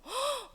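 quick shocked intake of breath; storyteller's gasp. Pretend. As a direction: gasp at the threat. Reacting to the king's threat. (놀라서 헉 숨 들이쉬기)

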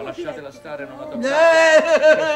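a loud, high, drawn-out don't touch her; shout. Acted. Commendatore shouting the visitors away, loud and slow. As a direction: yell at them to keep their hands off. Shouting guests off epileptic girl.

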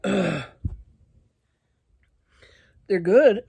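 ordinary talk

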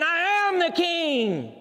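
high, slow proud proclamation; lion's boast. Acted. The beast declares itself king, slow and proud.

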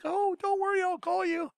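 husband mocking wife's promise in high sing-song; mock reassurance. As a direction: parody her empty promise in a high voice. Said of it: "she was gonna be like".

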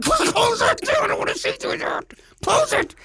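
frantic high-pitched yelp; panicked high plea. Retold or imitated. Terrified husband begging wife to shut the window, high panicked yelp. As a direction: squeal it in terror at your wife.

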